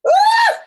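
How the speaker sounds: high loud squeal of overwhelm; excited squeal. Real, not acted. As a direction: let out a sudden squeal of emotion. Host overwhelmed by the poem.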